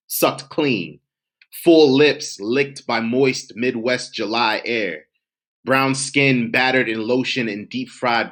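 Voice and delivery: savoring, rhythmic recitation; spoken word poem. Acted. Poet recalling a summer cookout, rhythmic spoken word.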